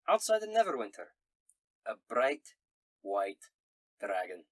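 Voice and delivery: hearty Scottish letter-writer; Scottish accent. Acted. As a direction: read the letter aloud in a Scots brogue. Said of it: "in a Scottish accent".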